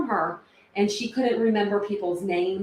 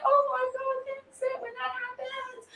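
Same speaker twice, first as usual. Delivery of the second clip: tearful wail; 6 dB quieter than usual. Retold or imitated. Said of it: "I was still like".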